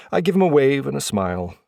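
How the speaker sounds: usual speaking voice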